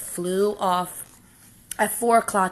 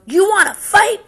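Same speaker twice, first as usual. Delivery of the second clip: high, loud taunt with a rising end; bully's dare; 14 semitones higher, 12 dB louder than usual. Acted. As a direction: dare him to fight. Grouchy ladybug picks fight.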